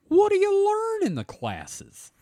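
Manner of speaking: squeaky falsetto question; high squeaky voice. Pretend. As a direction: pitch it way up in falsetto.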